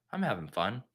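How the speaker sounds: low, soft, mock-defeated repetition; deflated self-convincing. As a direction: say it small, losing faith in it. Mock breakdown, mantra dies down.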